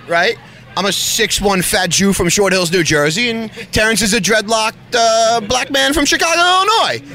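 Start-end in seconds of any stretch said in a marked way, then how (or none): none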